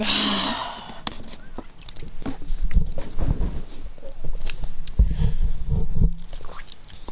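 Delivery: a long, drawn-out weary sigh. Acted. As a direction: let out one long tired breath by the campfire. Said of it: "just sits there going".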